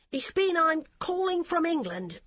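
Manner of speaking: flustered. Acted. Fumbling for German on the phone.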